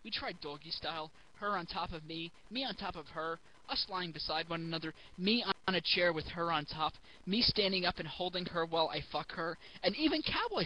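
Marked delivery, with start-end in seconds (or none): none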